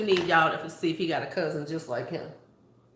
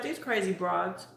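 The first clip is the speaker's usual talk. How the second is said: mock stranger's remark. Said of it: "She was like".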